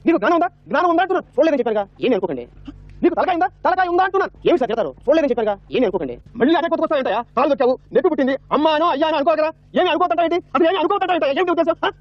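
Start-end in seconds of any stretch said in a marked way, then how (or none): 0.1-1.3 indignant scolding. Acted. Scolding the man who stepped. 3.0-4.3 indignant scolding. Acted. Scolding the man who stepped. 6.4-11.9 high-pitched exasperated ranting; indignant scolding. Acted. Scolding the man who stepped.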